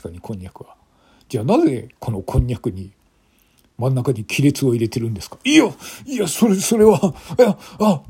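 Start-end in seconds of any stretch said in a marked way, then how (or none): 5.4-8.0 high, frantic stammering excuse; panicked stammer. Acted. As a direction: stumble over the words in panic. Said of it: "男は明らかに動揺している様子だ".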